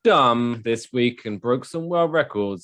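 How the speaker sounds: slow, emphatic segment intro; show announcer. Acted. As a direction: announce the segment grandly and slowly.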